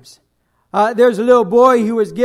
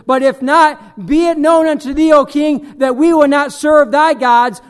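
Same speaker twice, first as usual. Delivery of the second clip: defiant proclamation; 4 semitones higher, 7 dB louder than usual. Acted. Quoting Hebrew children to king.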